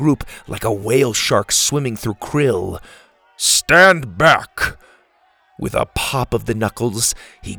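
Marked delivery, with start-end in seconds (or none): 3.4-4.8 loud, commanding big-man voice; Boom. Acted. Huge man about to break the lock, booming command. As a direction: order everyone aside with full force.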